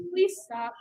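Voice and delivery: low, soft, controlled request; very calm. Acted. Coach models answering a sibling who picks on them, very calmly. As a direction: ask gently after a calming breath. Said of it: "You say it very calmly".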